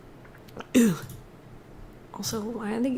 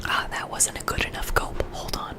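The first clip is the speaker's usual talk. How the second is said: soft close whisper; ASMR whisper; 4 dB quieter, faster than usual. Real, not acted. Streamer whispering through earbud trouble to chat.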